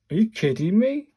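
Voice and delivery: you can't be serious; exasperated outburst; incredulous. Real, not acted. Reacting to the answer.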